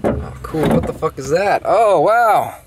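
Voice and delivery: high-pitched, delighted discovery; real surprise. Real, not acted. Car owner finding a mystery part, real surprise. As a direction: pitch leaps up in amazement.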